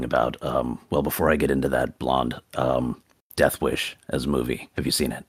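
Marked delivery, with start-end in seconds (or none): none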